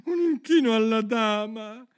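high, loud, measured command; calling dance steps. A narrator's reading. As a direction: sing out each step like a dance master. (voce alta che scandisce i passi)

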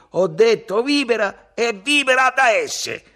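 high, loud, slow insistence; ferocious. A narrator's reading. Ugo turns on the musicians, ferocious. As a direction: shout it as a final order. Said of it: "rivolgendosi ferocemente ai suonatori".

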